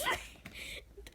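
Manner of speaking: breathless silent laugh; silent wheezing laugh. Real, not acted. Reader losing it over odd lines, silent wheeze.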